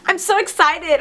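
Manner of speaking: excited, lifted-pitch squeal. A narrator's reading. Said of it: "I squeal".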